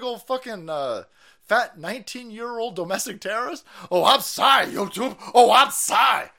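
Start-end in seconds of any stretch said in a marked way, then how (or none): 3.9-5.2 loud sarcastic "Oh, I'm sorry" with a sneer; fake-apologize loudly, dripping sarcasm; mock apology. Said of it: "I better be on my best behavior". 5.3-6.3 loud sarcastic "Oh, I'm sorry" with a sneer; fake-apologize loudly, dripping sarcasm; mock apology. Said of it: "I better be on my best behavior".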